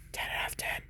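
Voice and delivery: hushed, whispered rating. Real, not acted. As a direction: drop to a whisper for the verdict. Whispered verdict on a film.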